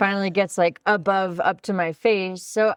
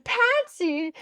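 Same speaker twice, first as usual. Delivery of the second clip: incredulous; 12 semitones higher than usual. Retold or imitated. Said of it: "And I said".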